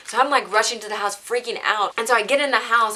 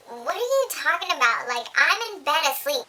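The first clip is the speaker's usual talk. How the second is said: higher, put-on innocent voice; playing innocent; 7 semitones higher than usual. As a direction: act baffled to cover the lie. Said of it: "I was like".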